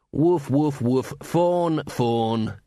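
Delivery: woof spoken as words, not barked; saying woof. A narrator's reading. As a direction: say the barks as plain words, lightly voiced. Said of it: "when he said woof instead of barking".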